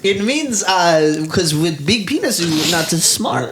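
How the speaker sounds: put-on high Greek grandma accent; lightly voiced Greek grandma. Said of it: "She goes".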